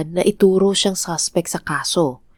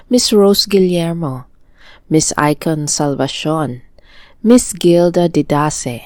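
slow, deliberate listing of loyal viewers' names; slow shout-out roll call; slower than usual. Real, not acted. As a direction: announce every viewer's name with care, one by one.

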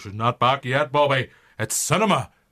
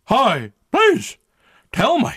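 high, louder begging; 11 semitones higher, 5 dB louder, slower than usual. Acted. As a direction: plead with him, desperate. Marty begs Kevin for a fix.

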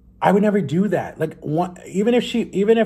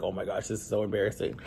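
muttered low and much softer; embarrassed mutter; 6 semitones lower, 11 dB quieter than usual. Retold or imitated. Stuck in the car seeing his old crush, softly mortified. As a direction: say it under your breath, cringing.

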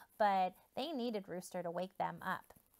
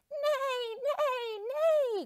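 very high, loud whinny; whinny like a horse with a laugh in it; horse neighing; 14 semitones higher, 6 dB louder, much slower than usual. Acted. Horse tries to crow the farm awake with high neighs. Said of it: "crowed the horse".

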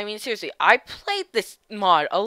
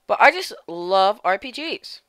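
ordinary talk, nothing marked

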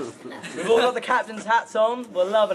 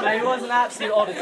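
usual speaking voice

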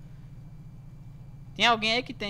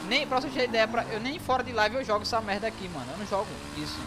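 ordinary talk, nothing marked

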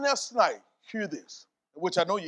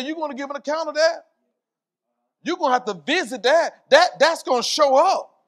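high, loud preacher's warning; impassioned preaching; 9 semitones higher, 11 dB louder than usual. Real, not acted. Preacher warns congregation they will answer for their words, impassioned. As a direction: drive the warning home with raised voice.

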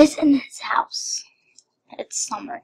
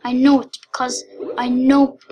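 usual speaking voice

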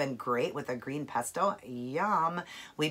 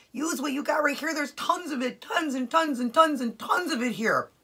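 insistent body voice; 8 semitones higher than usual. Pretend. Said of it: "Your body's telling you".

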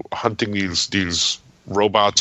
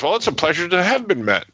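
higher, put-on quip voice; rehearsed pat answer; 9 semitones higher than usual. Stan greeting a fan with rehearsed answer, pat quip. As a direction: deliver a practised comeback in a raised voice. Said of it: "these pet answers, you know, that he has rehearsed".

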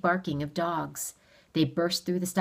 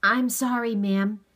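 higher, louder earnest apology; raised, pressing appeal; urgent apology; 6 semitones higher, 6 dB louder than usual. A narrator's reading. Boy urgently appeals for help.

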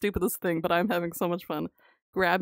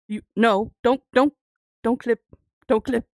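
louder urgent protest; loud pleading; 7 dB louder than usual. Real, not acted. Streamer pleads loudly with the game not to clip. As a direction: beg the game not to glitch.

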